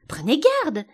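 high, loud warning; crying out. A narrator's reading. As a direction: call out in alarm. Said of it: "s'écria Alice".

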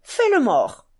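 sharp loud call of a trick command; boy shouting a dog command; shouted command. Acted. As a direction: bark the order at the dog. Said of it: "cria aussitôt à Carillon".

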